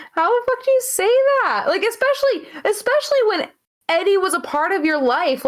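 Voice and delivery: can't believe she said that; incredulous outrage. Real, not acted. Shocked at horrible comment.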